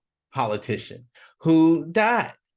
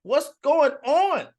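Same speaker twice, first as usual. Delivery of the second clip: desperate plea; 10 semitones higher than usual. Acted. Politician confronts the devil, high desperate plea. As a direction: beg the devil for an answer. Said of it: "And he said".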